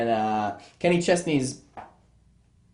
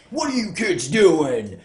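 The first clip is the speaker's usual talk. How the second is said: put-on slow, raised yell at the kids; angry neighbour shout; 4 semitones higher, 6 dB louder, slower than usual. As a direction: holler it like a riled homeowner. Neighbour catches kids at mailbox.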